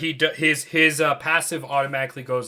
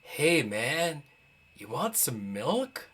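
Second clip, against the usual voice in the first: heavy-lidded tiefling, voice dropped and unhurried; heavy-eyed tiefling; 6 dB quieter, much slower than usual. Acted. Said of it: "really heavy. He goes".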